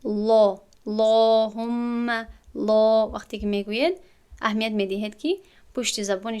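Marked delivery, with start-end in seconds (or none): 0.0-3.1 لا and اللهم with the back of the tongue raised, full and louder; heavy lam. Shown as a demonstration. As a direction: make the lam thick and heavy. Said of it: "پشتش به کام دهان بالا می شود".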